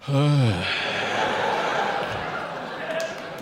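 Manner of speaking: big breathy gasp for air; gasping breath. Shown as a demonstration. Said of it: "you're eating and you go".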